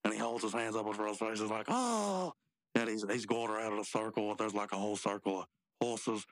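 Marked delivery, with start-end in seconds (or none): 1.7-2.3 high, drawn-out "oh"; pained cry. Acted. Rigby blinded by glitter, high acted cry.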